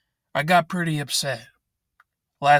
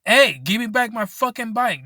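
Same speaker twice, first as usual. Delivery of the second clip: higher and louder, demanding the bike back; mock-shout at the thief; yelling at a bike thief to hand it back; angry retold yell; 7 semitones higher, 5 dB louder than usual. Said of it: "And I'm like".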